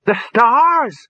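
very high, loud cry; boy's excited shout. A narrator's reading. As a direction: call it out to the crowd. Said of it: "shouted the boy".

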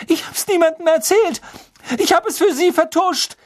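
hoch und laut, außer sich; verratene Wut. A narrator's reading. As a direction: die Enttäuschung herausschreien. Hermine schreit Lupin an. (Hermine schreit Lupin wütend an)

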